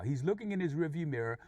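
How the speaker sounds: usual speaking voice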